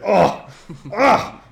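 loud, high cries of excitement; thrilled outburst. Real, not acted. Fan reliving Thor and Bill's lift, thrilled. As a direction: burst out with two big "oh"s and a laugh. Thrilled retelling of comic scene.

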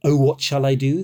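ordinary delivery

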